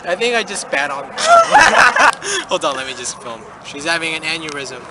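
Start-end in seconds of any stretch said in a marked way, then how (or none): 1.2-2.4 high loud burst of laughter; cracking up loudly. Real, not acted. Student cracks up telling friends the story, loud high laugh.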